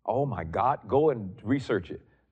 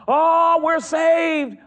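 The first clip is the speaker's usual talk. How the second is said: hammed-up high, loud TV preacher; mock the TV preacher's gushing praise; 10 dB louder, much slower than usual. Mocking a television preacher who mixes the covenants, hammed-up. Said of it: "you hear somebody mixing it".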